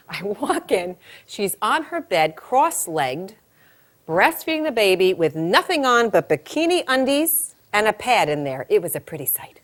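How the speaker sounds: ordinary talk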